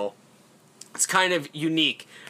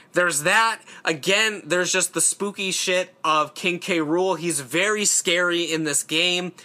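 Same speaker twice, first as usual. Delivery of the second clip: ordinary talk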